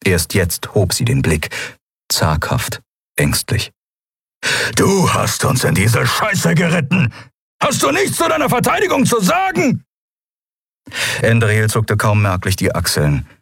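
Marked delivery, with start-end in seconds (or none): 4.8-9.8 building to a high-pitched shout; enraged, high and strained; furious accusation. A narrator's reading. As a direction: lay into your captain, voice climbing. Kero confronts the captain.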